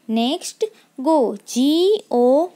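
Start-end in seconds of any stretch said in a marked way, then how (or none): 1.5-2.5 each letter spaced out slowly; slow dictation of letters; slow spelling. Shown as a demonstration. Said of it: "teacher spells word for class".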